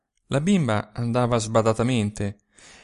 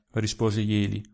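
plain reading voice

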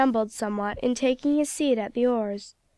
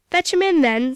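sharp, louder, higher bark of an order; losing his temper; 4 semitones higher, 5 dB louder than usual. Acted. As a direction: snap it out, fed up. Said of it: "losing his temper".

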